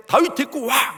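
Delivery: high-pitched angry summons; angry Saul's command. Acted. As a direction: order him brought in, furious. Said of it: "그러면서 막 화가 나고". (화난 왕의 높은 명령조)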